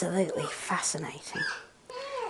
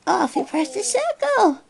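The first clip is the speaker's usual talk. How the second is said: high, delighted sing-song; 12 semitones higher than usual. Real, not acted. Talking to the baby.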